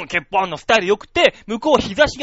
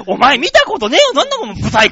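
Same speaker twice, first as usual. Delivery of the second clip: rude complaint; 6 semitones higher, 7 dB louder than usual. Retold or imitated. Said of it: "ってやつ".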